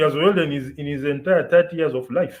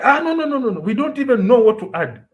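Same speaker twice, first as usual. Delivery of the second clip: mock official's protest; 7 semitones higher, 4 dB louder, faster than usual. Official's reply imitated.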